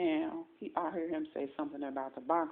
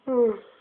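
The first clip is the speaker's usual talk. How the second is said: heavy sigh; 9 semitones higher, 14 dB louder than usual. Real, not acted. Groggy speaker just woke up.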